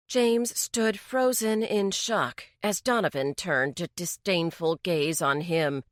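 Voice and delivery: ordinary delivery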